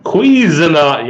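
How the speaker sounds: Cuisinart, slowly as KWIZ-uh-nart with a hard t; copying the clip. Shown as a demonstration. Trying out the new way to say the brand. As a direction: copy the proper pronunciation, slowly and loud. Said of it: "apparently I've been saying it wrong".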